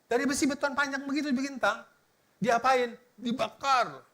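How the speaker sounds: ordinary talk, nothing marked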